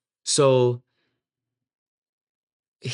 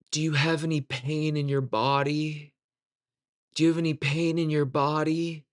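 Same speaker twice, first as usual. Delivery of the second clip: put-on pious healer, pitched higher; mock holy concern, drawn into a higher register; 4 semitones higher than usual. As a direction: imitate a falsely humble preacher. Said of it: "he says in this very pious voice".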